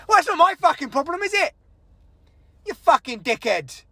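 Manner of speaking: high, indignant comeback; exasperated, confrontational retort; angry retort. Retold or imitated. Acted quarrel with the manager.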